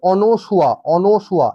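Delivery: অনসুয়া as ɔ-no-shua, open first vowel; correct pronunciation. Shown as a demonstration. Teacher correcting students' pronunciation, clearly modelled অনসুয়া with "no". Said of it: "উচ্চারণটা কিন্তু".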